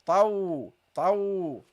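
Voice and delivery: tau said heavy and emphatic; teacher drills heavy tau. Shown as a demonstration. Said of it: "so you start heavy".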